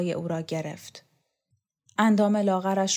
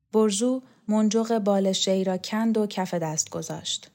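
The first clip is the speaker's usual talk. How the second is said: ordinary delivery, nothing marked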